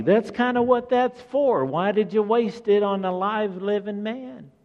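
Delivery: Judas mocked. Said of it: "Judas is like".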